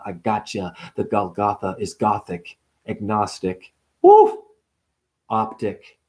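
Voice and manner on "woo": high whoop. Acted. Sudden shriek inside wordplay.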